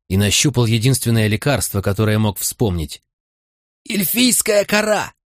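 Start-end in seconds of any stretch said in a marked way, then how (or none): 3.9-5.1 high, slow, strained rasp; hoarse croak. Acted. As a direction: croak it out with no breath. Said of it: "прохрипел я".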